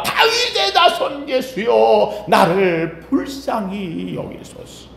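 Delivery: scream-like plea. Acted. Blind beggar being dragged off pleads to Jesus, drawn-out cry. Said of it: "크게 소리 질러 비명 지르듯이".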